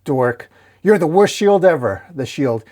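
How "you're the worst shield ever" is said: high, loud complaint; exasperated scolding. A narrator's reading. Dork scolding the rampaging shield, exasperated. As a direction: throw the insult out in frustration.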